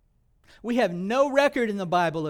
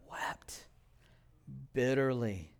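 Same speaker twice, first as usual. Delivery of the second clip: whispered, much softer; hushed; 5 semitones lower, 8 dB quieter, slower than usual. Acted. Preacher hushing to a whisper as Peter weeps. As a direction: drop to a whisper for the weeping.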